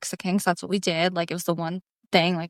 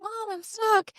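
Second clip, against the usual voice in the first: put-on high, small kid's voice, softer; a touch of a stuck child; 13 semitones higher, 6 dB quieter than usual. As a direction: lightly voice the little girl calling Mom. Daughter calls to mom, stuck.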